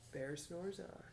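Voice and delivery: soft, near-whispered title; hushed title read. A narrator's reading. Teacher reads the title page to children, hushed. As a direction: open the book in a hush.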